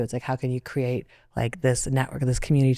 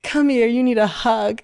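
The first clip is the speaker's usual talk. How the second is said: higher, slower, a touch louder, caring stranger; kind stranger; 9 semitones higher, 5 dB louder than usual. Retold or imitated. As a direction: offer a hug with open warmth. Stranger offers a hug.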